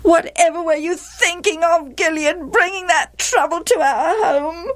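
high-pitched nagging wife. A narrator's reading. Wife blaming Gilead for bringing trouble home, nagging. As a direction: nag on and on in a high, aggrieved voice. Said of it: "Gilead's wife nagged".

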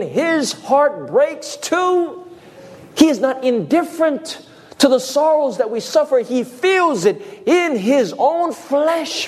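high, very slow impassioned preaching; preaching climax. Real, not acted. Preacher reaching the climax about God's sorrow, high and slow. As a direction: cry it out to the congregation.

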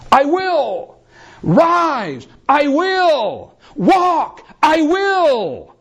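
high, emphatic preacher's cry; triumphant response. Acted. Lame man answering the command to walk, triumphant. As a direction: alternate command and answer, pitched high and forceful.

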